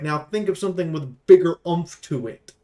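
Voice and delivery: normal talking voice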